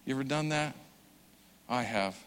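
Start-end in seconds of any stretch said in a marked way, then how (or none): none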